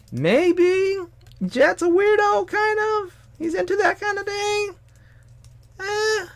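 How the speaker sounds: very high squeaky voice; mock gossip voice. Voicing followers' assumption.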